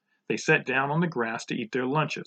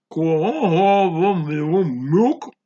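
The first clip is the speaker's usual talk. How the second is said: slow, loud, muffled words, pitched up; mouth full; 5 semitones higher, 9 dB louder, slower than usual. A narrator's reading. Joy asking for milk, mouth full. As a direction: talk thickly round a mouthful. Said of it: "her mouth was full".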